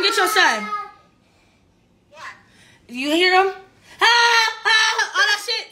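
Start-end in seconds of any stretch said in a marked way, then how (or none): 4.0-5.7 laughing cry. Real, not acted. Laughing while child wails.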